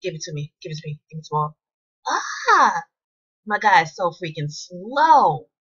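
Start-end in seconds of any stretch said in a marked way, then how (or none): 2.1-5.5 frustrated outburst. Real, not acted. Annoyed at her slow player.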